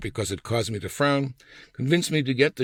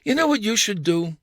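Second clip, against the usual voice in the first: wife's bossy advice; 9 semitones higher than usual. Retold or imitated. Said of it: "the Lucy side of you-know-who appeared".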